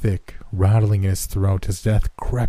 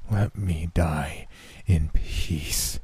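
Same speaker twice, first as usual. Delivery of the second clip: very slow and softer, each word dragged out; slowly; 3 semitones lower, 5 dB quieter, much slower than usual. A narrator's reading. Dying man begging the nurse to stop, very slow and soft. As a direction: draw the plea out, slow and low-energy. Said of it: "he said slowly".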